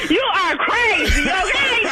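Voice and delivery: high, laughing disbelief; cracking up while talking; laughing in relief. Real, not acted. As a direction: squeal it slowly through real laughter. Clerk laughing after prank reveal.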